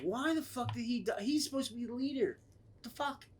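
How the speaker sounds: high, hushed disbelief; baffled protest. Retold or imitated. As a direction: complain softly in a high, baffled voice. Acting out his baffled reaction.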